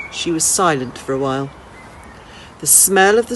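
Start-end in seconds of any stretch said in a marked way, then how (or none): none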